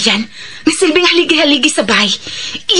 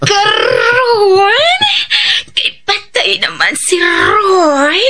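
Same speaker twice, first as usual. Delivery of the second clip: high shrieking laughter breaking into sobs; hysterical laugh-cry; 5 semitones higher, much slower than usual. Acted. Widow laughing and crying over hidden money, shrieking. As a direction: laugh and wail at once, out of control.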